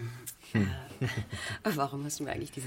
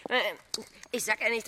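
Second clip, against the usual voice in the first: ordinary talk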